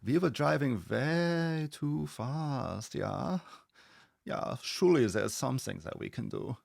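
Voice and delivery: slow, put-on German accent; drawn-out, exaggerated German accent; German movie character. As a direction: do a comic German accent, stretching the words. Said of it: "the German dude in the Porsche from Super Troopers".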